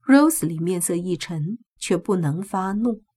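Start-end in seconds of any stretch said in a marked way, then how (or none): none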